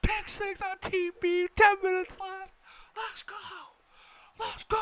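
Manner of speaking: very high, very loud screams; ecstatic screaming. Real, not acted. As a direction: scream it like the crowd is around you. Fan screaming after pick six.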